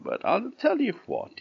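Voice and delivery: sugary false kindness; fake-friendly wolf. A narrator's reading. Wolf pretending nice.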